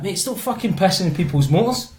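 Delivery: ordinary talk, nothing marked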